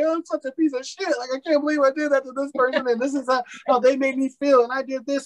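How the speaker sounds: high, anguished self-blame, partly faked; retold self-reproach. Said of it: "I cried during all of this".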